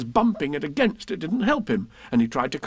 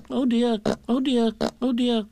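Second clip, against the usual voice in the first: high, fretful little worrier; Piglet; 7 semitones higher than usual. A narrator's reading. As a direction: fret to yourself in a high small voice. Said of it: "said Piglet to himself".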